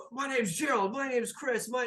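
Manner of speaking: high, excited crowd chatter; eager introductions. Acted. Sailors scattering round to pal up, eager introductions.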